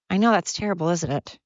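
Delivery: normal talking voice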